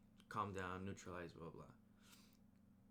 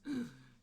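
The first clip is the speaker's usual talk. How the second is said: mock gasp; 5 semitones higher, 4 dB louder than usual. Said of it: "he just goes".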